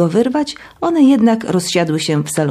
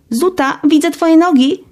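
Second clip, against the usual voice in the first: knowing call-out; 10 semitones higher, 3 dB louder than usual. Acted. Katia calling out hidden Zuta.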